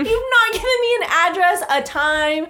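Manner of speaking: put-on high, loud British accent; hammed-up British complaint; continuing the British scold; mock British rant.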